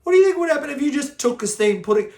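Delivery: high, fast, loud disbelieving squeal; incredulous excited quote. Retold or imitated. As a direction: fire the question back in amused disbelief.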